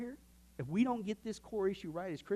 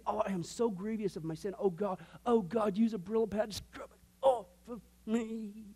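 mock anguished plea; 3 semitones higher than usual. Preacher acting out David's anguished prayer.

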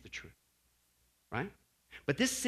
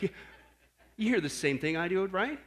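ordinary talk, nothing marked

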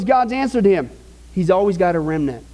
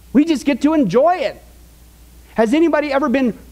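higher, slow, rising preacher's call; preaching at full force; 5 semitones higher than usual. Acted. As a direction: lift the congregation with each line. Preaching builds, higher and slower.

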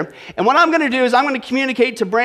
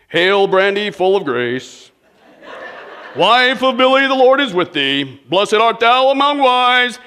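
mock liturgical; slower than usual. Pastor greets his wife in mock liturgy. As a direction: intone it like a mock Hail Mary. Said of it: "a little bit more liturgical".

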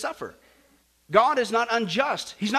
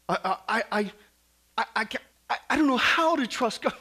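mock stammering reluctance. Mimics hesitant believer stammering excuses.

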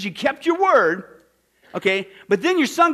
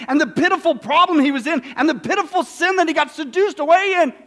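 usual speaking voice

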